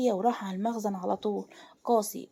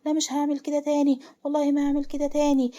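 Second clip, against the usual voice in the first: high, loud panicked begging; screaming plea; 5 semitones higher, 6 dB louder than usual. A narrator's reading. Ayman begging not to lose his tongue, screaming. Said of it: "أيمن بصريخ".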